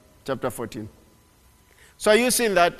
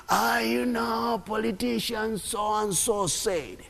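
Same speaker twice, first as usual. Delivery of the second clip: mocking gossip; 7 semitones higher, much slower than usual. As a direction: sneer at idle gossip. Mocking people repeating politicians.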